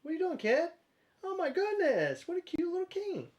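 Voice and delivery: high cooing voice to a pet. Real, not acted. Baby talk to the cat.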